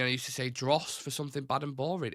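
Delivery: ordinary delivery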